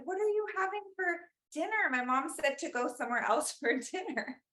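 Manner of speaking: put-on high, quick, innocent child voice; little kid at the door. Five-year-old asking the neighbor what's for dinner, high and quick. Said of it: "I said".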